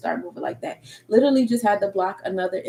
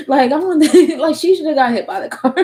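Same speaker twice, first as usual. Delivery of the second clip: laughing; 6 semitones higher, 6 dB louder than usual. Real, not acted. Laughing at own outburst.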